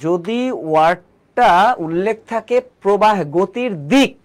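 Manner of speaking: slow, with থাকে leaned on heavily; stressing key words. Shown as a demonstration. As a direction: drag it out and hammer থাকে so students catch it. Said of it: "কিছু ওয়ার্ডে খুব জোর দেব".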